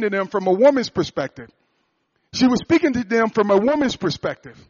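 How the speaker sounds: loud, high, driving sermon delivery; preaching at full force. Acted. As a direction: build it up like a revival preacher.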